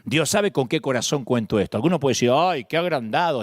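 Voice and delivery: usual speaking voice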